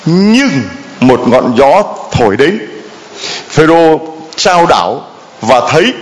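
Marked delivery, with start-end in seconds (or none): none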